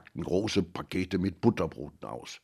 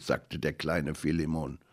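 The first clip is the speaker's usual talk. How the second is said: plain reading voice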